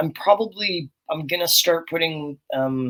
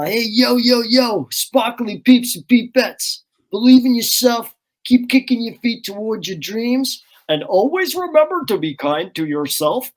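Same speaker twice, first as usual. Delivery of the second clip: put-on upbeat kids' host shout-out, higher and louder; hyped sign-off voice; 5 semitones higher, 4 dB louder than usual. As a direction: pump up the kids with a cheerful sign-off. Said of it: "So I'd always be like".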